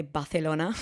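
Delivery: "Barcelona" with a th sound for the c; lisped pronunciation. Shown as a demonstration. Telling co-host what her boyfriend dislikes, lisped "Barcelona". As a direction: say "Barcelona" as Bathelona, Spanish-style. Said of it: "doesn't like it when I say".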